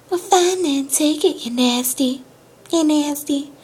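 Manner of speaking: high, hushed country lady impression; soft country accent. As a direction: echo her country twang small and high. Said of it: "in her little accent".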